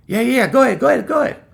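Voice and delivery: high, loud brush-off; dismissive boss. Retold or imitated. Boss waving off worker's break request, high and dismissive. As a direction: rattle it off like a busy boss waving you away.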